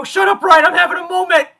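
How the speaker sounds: loud, high, choked-up snap at a brother; tearful yell. Retold or imitated. Brother asks why, she yells back through tears. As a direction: shout him off through tears. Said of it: "just bawling my eyes out".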